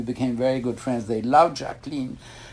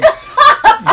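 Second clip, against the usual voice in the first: high loud laugh at the bad luck; burst of laughter; 11 dB louder than usual. Real, not acted. Telling the interviewer the boat left again, bursting out laughing. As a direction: laugh out at yet another missed boat.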